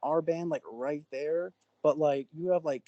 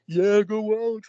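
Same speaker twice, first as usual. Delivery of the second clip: loud, higher-pitched acted cheer; urging on; 5 semitones higher, 8 dB louder than usual. Bus friends send Alex out, loudly urging. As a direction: egg him on like a rowdy teammate.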